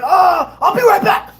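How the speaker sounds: high, loud excited shout; imitated frantic fan. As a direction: act out a kid dashing off to fetch his friends. Excited kid rushes off.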